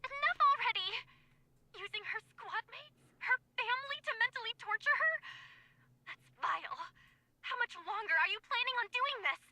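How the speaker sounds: high furious outburst; angry. Acted. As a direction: shout it in outrage.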